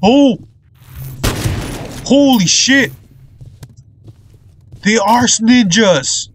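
high-pitched startled yelling; astonished, high and forceful; shocked outcry. Real, not acted. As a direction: exclaim in alarm, pitch way up. Enemies suddenly attack in game.

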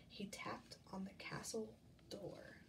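hushed storytelling whisper. A narrator's reading. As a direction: breathe it out in secret. Quiet suspense at castle door.

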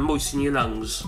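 ordinary delivery

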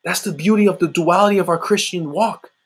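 ordinary talk

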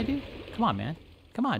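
ordinary talk

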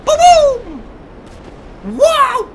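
loud, high whoop; excited exclamation. Real, not acted. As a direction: shout at the blast. Explosion in the game.

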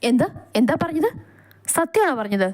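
shocked disbelief on the phone; disbelieving. A narrator's reading. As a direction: ask as if you can't believe the news. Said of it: "കേട്ടത് വിശ്വസിക്കാനാവാതെ".

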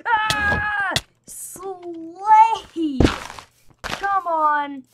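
high, loud fighting shout; excited battle cry. Real, not acted. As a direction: cheer yourself on.

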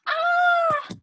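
high mock "aah" of alarm; drawn-out, high pretend scream. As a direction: cry out for the story's disaster. Reacting to the broom snapping.

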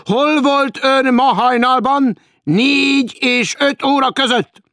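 loud, slow, high courtroom accusation. A narrator's reading. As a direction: thunder it like a grim public prosecutor. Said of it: "És felemelte a hangját, mint egy zord közvádló".

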